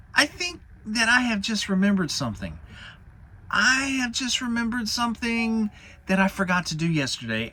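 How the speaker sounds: squeaky Piglet inventing a reason to leave; nervous Piglet. Acted. Narrator voices Piglet.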